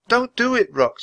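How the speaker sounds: put-on high-pitched girl's plea; high, urgent little girl voice; urging girl. A narrator's reading. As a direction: beg the bird to resist. Annie begs the bird to resist.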